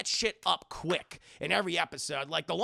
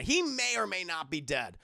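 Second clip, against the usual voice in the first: usual speaking voice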